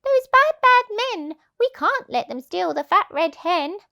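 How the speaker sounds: loud, high protesting meow-voice; indignant cats. Acted. Cats protesting to the animals, loud and high. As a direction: cry out like outraged cats. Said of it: "the two cats meowed".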